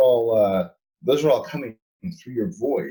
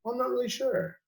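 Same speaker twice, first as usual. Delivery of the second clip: high, soft unsure voice; clueless seeker; 14 semitones higher, 6 dB quieter than usual. Retold or imitated. Mocking the clueless seeker who doesn't know why, high and soft. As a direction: squeak it out, clueless.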